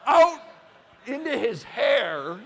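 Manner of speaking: loud, drawn-out shocked rise; horrified disbelief. Retold or imitated. Dad finding poop in his son's hair, horrified disbelief. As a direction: let the horror build as you see it.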